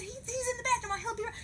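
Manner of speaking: very high, pleading protest; frantic insistence. Retold or imitated. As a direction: plead your case way up high. Acted frantic argument.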